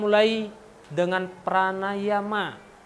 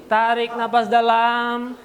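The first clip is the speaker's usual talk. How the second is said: slow breathing cue; 4 semitones higher, 5 dB louder, slower than usual. Shown as a demonstration. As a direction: stretch it out, slow. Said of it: "breathing exercise instruction".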